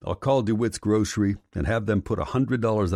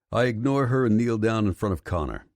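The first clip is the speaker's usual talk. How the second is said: ordinary delivery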